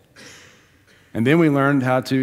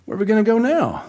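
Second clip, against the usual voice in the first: squeaky high-pitched "what now" panic; mock panicked squeal over the broken toilet; exasperated panic; 11 semitones higher, faster than usual. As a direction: voice jumps way up in comic despair. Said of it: "I said".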